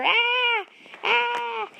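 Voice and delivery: high shrieking screams; chase screams. Acted. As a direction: scream while running away. Farmer and rat chase.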